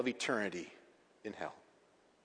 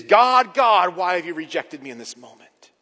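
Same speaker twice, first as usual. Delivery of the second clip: crying out; 4 semitones higher, 12 dB louder than usual. Retold or imitated. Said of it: "As he would cry out".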